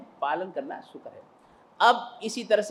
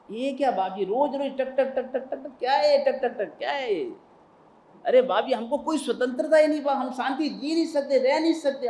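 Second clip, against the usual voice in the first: whiny indignant complaint; 6 semitones higher, 3 dB louder than usual. Acted. Disciple complains about constant questioning.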